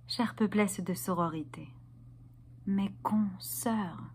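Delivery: low, soft and measured; hushed solemn address. Acted. Feminist performer addressing her sisters, hushed and solemn.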